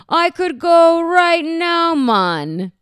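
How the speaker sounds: high, loud, bad Southern accent; drawn-out, hammed-up fake Southern accent; Joey. Joey retries his audition line, badly faked Southern accent. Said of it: "I could do it Southern".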